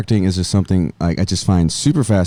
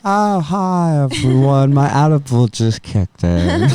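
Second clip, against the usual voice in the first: higher, dazed pretend greeting; teasing imitation of a deadpan friend, pitched up; mock Dulce at a party; 5 semitones higher, slower than usual. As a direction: play her barely reacting to a surprise. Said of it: "you getting thrown a surprise party".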